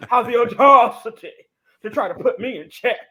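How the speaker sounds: loud, sputtering mock outrage; huffy mock indignation. Mocking the caretaker who scolded her, loud huffy outrage. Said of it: "she starts going off on me".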